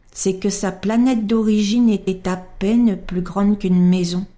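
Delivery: ordinary delivery, nothing marked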